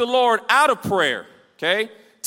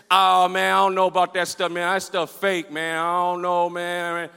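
imitated drunk; 3 semitones lower than usual. Drunk man dismissing talk about Jesus, imitated slurring. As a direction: play a drunk brushing it all off. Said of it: "He's super drunk".

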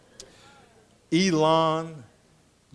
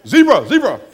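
waiter calling out; 2 semitones higher, 10 dB louder than usual. Retold or imitated. Waiter offering meat round the tables, calling loudly. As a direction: call out the dish like a waiter. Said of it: "they go".

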